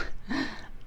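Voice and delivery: brief sheepish chuckle; a quick embarrassed laugh; soft laugh. Real, not acted. Forgot to press record.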